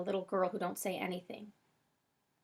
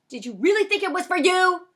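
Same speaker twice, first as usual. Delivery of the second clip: high, loud incredulous question; scornful shout; 10 semitones higher, 12 dB louder than usual. A narrator's reading. Frosty asks about the ringing phone, loud scornful disbelief. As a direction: yell it in exasperation.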